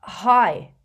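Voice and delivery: put-on a quick, uneasy hello to an angry girlfriend; caught-out boyfriend blurting a nervous hi. A narrator's reading. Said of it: "said Ron nervously".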